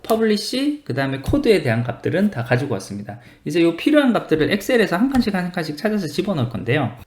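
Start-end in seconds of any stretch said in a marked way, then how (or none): none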